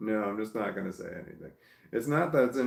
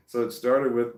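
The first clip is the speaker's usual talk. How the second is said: usual speaking voice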